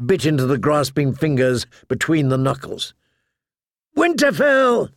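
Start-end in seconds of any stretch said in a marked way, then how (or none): 4.0-4.9 high battle scream. A narrator's reading. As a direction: cry it as you strike. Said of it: "she screamed".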